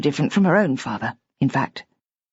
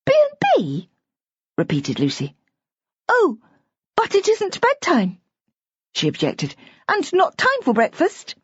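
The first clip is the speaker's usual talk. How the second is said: put-on a small girl finding the grown-up logic silly; puzzled little girl; 12 semitones higher than usual. A narrator's reading. Little girl objecting to Simmy's father about bedtime.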